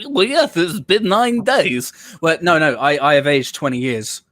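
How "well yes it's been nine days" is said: mock pedant. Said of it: "people will go".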